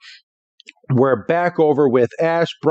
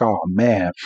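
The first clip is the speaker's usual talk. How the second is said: low, deflated mock dismay; a touch of sad resignation, lower; retold sad; 5 semitones lower than usual. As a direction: sigh it out a little glumly, imitating. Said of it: "Pikachu and Caterpie are kinda sad".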